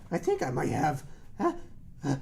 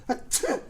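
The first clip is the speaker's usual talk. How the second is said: sneeze; 8 semitones higher than usual. Pretend. Dad pretends to keep sneezing.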